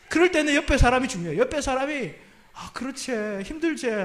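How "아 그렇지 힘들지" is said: comforting in a Gyeongsang dialect lilt. Shown as a demonstration. Pastor demos Gyeongsang comfort to congregation, Gyeongsang accent. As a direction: say it the Gyeongsang way, softly sympathetic. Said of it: "경상도 버전으로".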